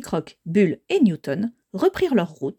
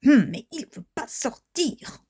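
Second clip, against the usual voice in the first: slow, higher, effortful voice; straining effort; 5 semitones higher, slower than usual. Acted. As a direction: tug hard as you say it, stretching words. Character tugging at stuck crystal. (voix d'effort, lente et forcée)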